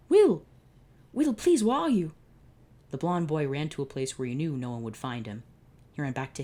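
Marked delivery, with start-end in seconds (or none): 0.1-2.1 loud, high desperate shout; frantic calling. Acted. Pharaoh hunts for Will, loud frantic call. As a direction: call out in panic.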